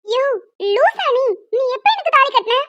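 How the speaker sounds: high angry yell stressing எனக்கு; shouting. Acted. Woman shouting at the man who claims to be her husband. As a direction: yell it at him in outrage. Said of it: "கத்தாதம்மா".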